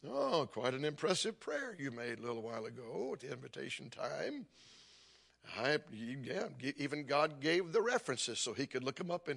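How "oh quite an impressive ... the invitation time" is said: a lowered, mildly voiced old preacher, dry and polite; John Rice's preaching voice. Evangelist gently rebuking the showy pastor, lightly mimicked preacher voice. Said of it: "now he talked like this when he preached".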